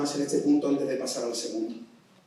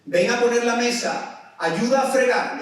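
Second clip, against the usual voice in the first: higher, louder, slow commands; parent's orders; 8 semitones higher, 6 dB louder, slower than usual. Retold or imitated. Preacher voices a parent calling chores, loud orders. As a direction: call out chores like a mum.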